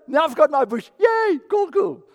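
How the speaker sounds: put-on high, loud childish glee; mock gleeful Jonah. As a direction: gloat like a spoiled kid. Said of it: "He's so narcissistic".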